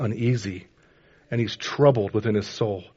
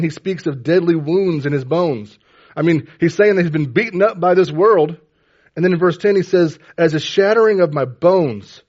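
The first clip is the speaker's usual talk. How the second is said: loud, raised preaching voice; impassioned preaching; 5 semitones higher, 7 dB louder than usual. Acted. Preacher retelling the psalmist's plight to the congregation, loud and impassioned. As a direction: preach it out forcefully over the room. Preaches and reads verse forcefully.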